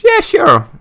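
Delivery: mock excited. Real, not acted. Mock-enthusiastic aside.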